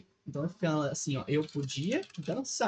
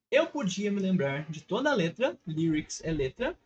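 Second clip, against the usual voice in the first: normal talking voice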